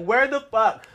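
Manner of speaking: high-pitched exasperated demand; indignant, retold outburst; mad. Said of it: "I was mad".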